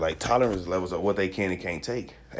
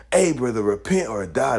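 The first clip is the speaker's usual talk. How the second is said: loud warning; 4 semitones higher, 4 dB louder than usual. Retold or imitated. Acting street preacher warning.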